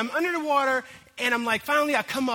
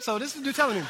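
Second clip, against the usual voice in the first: normal talking voice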